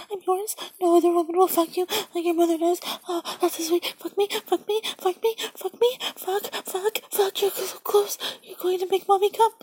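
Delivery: urgent rising pleasure; breathless urgency. Acted. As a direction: speed up, pitch climbing. Climax approaching in roleplay.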